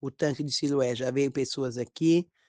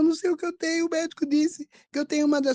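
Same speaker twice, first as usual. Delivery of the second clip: high-pitched imitated worried plea; distressed son; 13 semitones higher, faster than usual. Sick son tells his mother about the illness, high distressed imitation.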